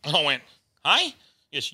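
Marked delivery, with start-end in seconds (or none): 0.8-1.2 high confused rise; baffled "hey". Retold or imitated. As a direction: sound totally thrown. Confused reply to weird question.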